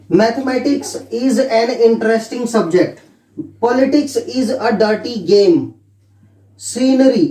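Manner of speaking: very slow, word by word, for students to copy; slow dictation. Shown as a demonstration. Teacher dictating example sentences to students, very slow. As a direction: spell it out slowly like dictation. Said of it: "dictating example sentences".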